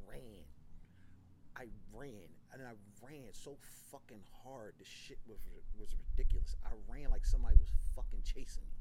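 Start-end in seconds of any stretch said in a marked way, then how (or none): 5.8-8.8 loud telling. Retold or imitated. Telling of frantic running.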